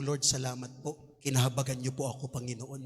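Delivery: soft, low, humble thanks to God; grateful prayer. Retold or imitated. Churchgoer thanking the Lord at home, quietly. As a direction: whisper thanks like a forgiven believer. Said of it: "sabi mo".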